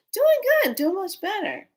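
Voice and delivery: high-pitched, chirpy answer; retold cheery phone small talk; upbeat reply.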